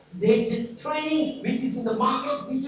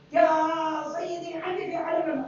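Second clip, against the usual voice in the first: Egyptian villager Arabic; 7 semitones higher than usual. Retold or imitated. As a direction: mimic an Egyptian villager complaining to the doctor. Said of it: "But the tone and accent".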